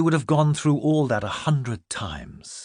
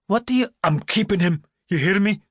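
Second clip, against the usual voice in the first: high loud insistent protest; defiant outburst; 9 semitones higher, 4 dB louder than usual. Acted. Milly defying the adults over her baby, high and insistent. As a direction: fight to keep him.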